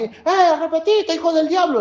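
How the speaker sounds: put-on the wrong way, scold them as a child of the devil; harsh preacher. Shown as a demonstration. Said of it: "no, no así".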